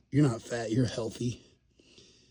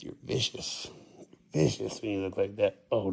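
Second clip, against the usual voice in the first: normal talking voice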